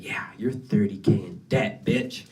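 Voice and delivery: hushed, gleeful instructor voice. Retold or imitated. Imitating the instructor happily announcing their debt, hushed. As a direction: deliver the debt news happily, under the breath. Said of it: "he literally said it like that, like he was happy".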